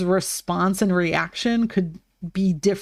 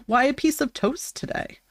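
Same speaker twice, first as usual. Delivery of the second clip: normal talking voice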